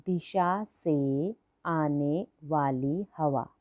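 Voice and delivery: each word drawn out very slowly for copying; teacher dictating a word meaning to class, very slow; slow dictation. Shown as a demonstration. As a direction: dictate slowly so children can write. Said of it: "slow dictation of meaning".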